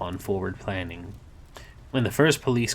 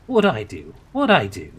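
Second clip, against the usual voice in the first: indignant protest; 2 semitones higher, 8 dB louder than usual. Acted. Crowley protesting, loud.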